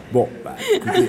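bursting into laughter mid-phrase; high laughing give-up. Real, not acted. Laughing wry giving-up remark.

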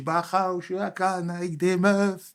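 high drawn-out phone-call impression. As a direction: imitate a friend calling with an invitation, high and slow. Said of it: "rejoindre le doc gynéco. Il m'appelle".

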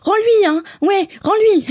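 high, loud cheering from behind; timid backup cheer. Pretend. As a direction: egg it on from the back. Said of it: "je serais comme ça, mais en restant derrière".

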